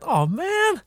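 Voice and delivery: a high moved sigh; touched sigh. Retold or imitated. Recalling the old man's joy, a high touched sigh. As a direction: sigh as if near tears. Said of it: "jag hade nästan tårar".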